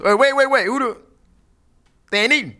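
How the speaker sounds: imitating a dad yelling about his fish; alarmed angry dad. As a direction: demand answers like a furious father. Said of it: "and he goes".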